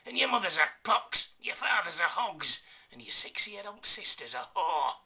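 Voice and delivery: high, loud cocky taunting; cocky yell. Acted. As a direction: keep yelling the insults, pleased with yourself. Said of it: "yelled the Yorkshireman, cocky and sure".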